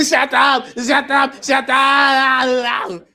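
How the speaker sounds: loud, high, drawn-out bellow; exasperated yell. Retold or imitated. First-week teacher losing it at kindergartners, exasperated loud yell. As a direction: yell it three times, stretching the last one. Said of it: "That's how I was".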